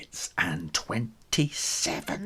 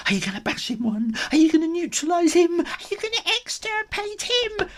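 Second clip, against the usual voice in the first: fast, rising higher and higher; excited egging on; 4 dB louder, faster than usual. Acted. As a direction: taunt faster, building to a squeak. Gang eggs bully on.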